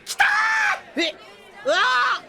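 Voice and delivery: shrieking with delight at the cards; excited shouting. Acted. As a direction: cheer like the pot is already won. Watching a big poker hand.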